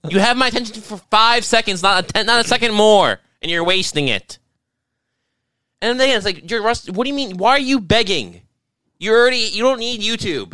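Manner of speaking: high, exasperated telling-off; indignant rant. Acted. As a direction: snap back in comic outrage at the begging. Indignant reply to begging YouTuber.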